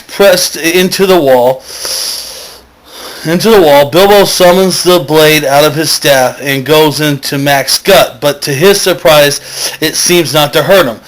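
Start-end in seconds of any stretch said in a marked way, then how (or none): none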